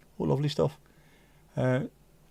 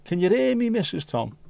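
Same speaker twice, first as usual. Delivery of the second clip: calling out; 7 semitones higher, 3 dB louder than usual. Acted. Calling out to Missus Tom.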